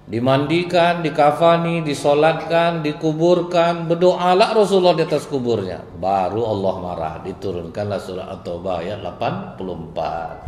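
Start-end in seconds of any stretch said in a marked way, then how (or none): 0.0-5.8 preaching climax. Real, not acted.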